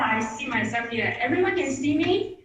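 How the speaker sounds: normal talking voice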